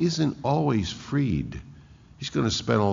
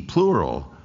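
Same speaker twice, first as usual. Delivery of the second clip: ordinary delivery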